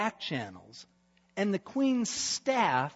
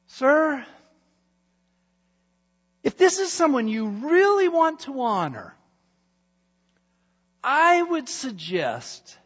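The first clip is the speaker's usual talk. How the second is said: higher, louder self-satisfied Haman; scheming courtier; 6 semitones higher, 9 dB louder than usual. Acted. Haman pitching honors he thinks are his, higher and louder. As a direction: savour every honor you suggest.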